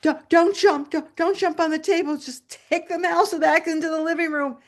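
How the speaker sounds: high, urgent pleading under pressure; anxious self-talk. Retold or imitated. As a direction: plead nervously, willing the cat away. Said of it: "I'm saying to myself".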